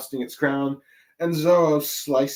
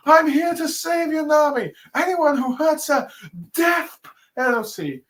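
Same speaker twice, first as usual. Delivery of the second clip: much higher, louder heroic vow; gallant Sanji; 14 semitones higher, 4 dB louder than usual. Retold or imitated. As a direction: swear to save her like a knight. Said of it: "is like".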